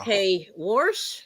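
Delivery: "warsh" drawn out, rising, x0.5; slow warsh. Shown as a demonstration. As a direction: try out "warsh" slowly. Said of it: "repeating the word".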